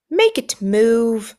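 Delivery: slow, drawn-out spoiled whine; whining. Acted. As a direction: zeur het langzaam als een verwend kind. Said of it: "he whined at his father".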